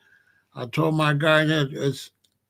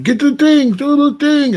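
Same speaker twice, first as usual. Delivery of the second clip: high, loud urgent yell; hollering medics; 10 semitones higher, 9 dB louder than usual. Retold or imitated. Medics rushing a stabbed man, loud high hollering. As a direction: shout orders down the hall.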